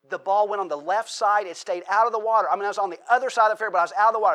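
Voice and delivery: racing through the lucky shot; gleeful retelling; excited telling. Retold or imitated. Retold lucky golf shot.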